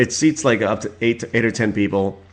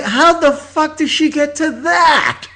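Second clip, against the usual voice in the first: high, loud, slowed disbelieving shout; much higher, louder shocked cry; stunned outburst; 4 dB louder, slower than usual. Retold or imitated. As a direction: yell it like you can't believe your ears. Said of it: "I was like".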